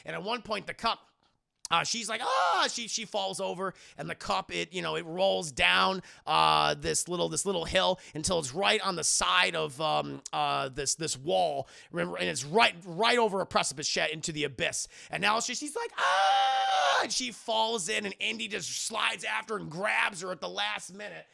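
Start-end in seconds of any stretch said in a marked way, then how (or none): 2.2-2.7 sudden high yelp of a woman falling; short startled shriek; quick hammed-up scream as she stumbles; high mock shriek. Elsa falls over. 16.0-18.1 hammed-up scream. Elsa falls into crevasse.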